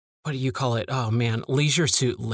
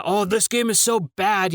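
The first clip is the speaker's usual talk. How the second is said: higher-pitched, put-on gamer outrage; mock nerd rage; 6 semitones higher than usual. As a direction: lightly fake an angry video game nerd. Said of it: "fake nerd rage".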